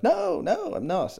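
high-pitched, hasty protest; mock defensive denial. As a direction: imitate someone covering up, voice jumping high. Acting a boyfriend's defensive denial.

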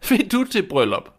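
high, sneering stepmother; scornful. Acted. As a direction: scoff at her request. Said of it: "spurgte hun hånligt". (hånlig, høj stedmor)

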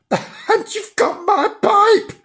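very high, slow cry of outrage; high-pitched indignant yell; outraged shout. Acted. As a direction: shout it drawn out in disbelief. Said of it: "he shouted".

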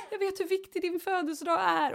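high mock apologetic husband; husband begging wife's forgiveness at the door; pleading husband. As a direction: ham up the sorry husband, pitched high. Same husband voice.

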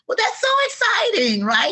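very high, gushing mock excitement; high-pitched, delighted encouragement; excited reaction. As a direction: squeal it lightly, like cheering a friend's news. Acted upbeat reply to friend's move.

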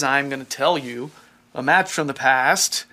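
ordinary delivery

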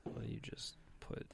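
soft unvoiced whisper. Real, not acted. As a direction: breathe it out quietly. Whispered aside.

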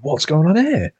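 puzzled retold question; confused.

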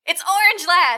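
loud, high announcement of the return; thrilled movie recap reveal; excited reveal. Retold or imitated. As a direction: announce it like a big surprise. Dramatic reveal while retelling film.

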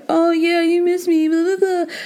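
high sneering mimicry; mocking. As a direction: mimic him with contempt. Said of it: "you were like".

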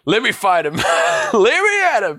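high-pitched, scrappy fight-me outburst; hammed-up challenge to a fight; eager fighter. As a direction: beg to be let at him, quick and high. Acting out a character eager to fight.

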